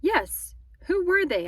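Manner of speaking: high, insistent question; demanding. A narrator's reading. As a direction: press firmly for an answer. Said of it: "I demanded".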